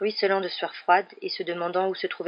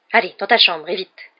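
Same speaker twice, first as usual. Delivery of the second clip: louder barked command; sharp order; 9 dB louder than usual. A narrator's reading. Aunt Petunia sending Harry to his room, sharp order. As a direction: order him out, no argument. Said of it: "ordonna-t-elle".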